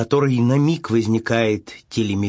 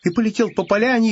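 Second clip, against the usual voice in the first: ordinary delivery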